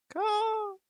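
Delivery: high sharp caw; a bird's croaking call; raven caw. Acted. Raven caws between sentences, high sharp cry.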